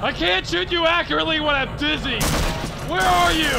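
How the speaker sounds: high, loud and frantic; frantic shout. Acted. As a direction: yell as the room spins. Character shouting mid-fight.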